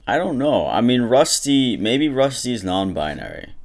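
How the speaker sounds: high, slow teasing drawl on Rusty; mock-muse about Rusty, stretching his name.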